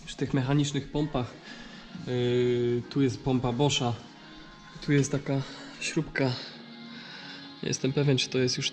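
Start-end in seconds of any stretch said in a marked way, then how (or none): none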